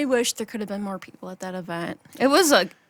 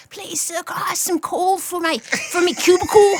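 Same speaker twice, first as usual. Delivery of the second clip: higher, mock Dickensian pleading; pleading orphan; 9 semitones higher, 4 dB louder than usual. Worker begging the manager for coal, hammed-up pleading. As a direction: beg for coal like a poor orphan.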